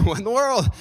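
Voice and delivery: high-pitched, hammed-up startled exclamation; mock astonishment. Balaam hearing his donkey talk, mock astonishment. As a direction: act out disbelief, pitch jumping up.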